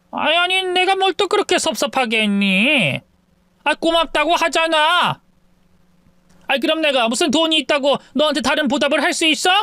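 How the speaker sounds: mother-in-law snapping back at the daughter-in-law; defensive mother-in-law. Acted. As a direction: bristle at being scolded by her.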